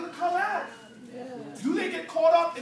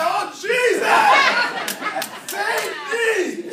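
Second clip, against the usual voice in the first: loud, high panicked plea to heaven; mock desperate cry; 6 semitones higher, 8 dB louder, much slower than usual. Friends in trouble suddenly calling on God, mock desperate shout. Imitates people praying when things go bad.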